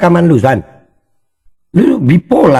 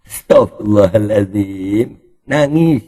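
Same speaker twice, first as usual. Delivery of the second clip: ordinary talk, nothing marked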